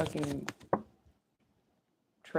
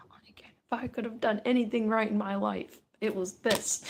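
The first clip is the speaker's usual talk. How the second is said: whispered joy; 5 semitones higher, 4 dB louder than usual. Retold or imitated. Winner's quoted inner thought.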